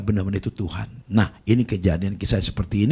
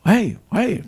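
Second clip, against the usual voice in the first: high, louder calling out; yelling; 12 semitones higher, 4 dB louder than usual. Retold or imitated. Sick man yelling at the pastor's door, high and loud. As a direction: shout for someone at the door. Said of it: "teriak-teriak dia".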